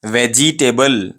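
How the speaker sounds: "वेजिटेबल" slowly as veh-jee-TAY-bul; long "jee" and full "table" in "वेजिटेबल"; wrong pronunciation. Shown as a demonstration. Teacher shows how we say it, slow full-syllable "वेजिटेबल". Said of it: "ये wrong pronunciation है".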